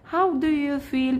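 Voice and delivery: very slow, clear English for learners; teacher reading a dialogue line very slowly; slow read-aloud. Shown as a demonstration. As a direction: spell each word out slowly for the class. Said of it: "بنراجع بقى على الدرس الاول".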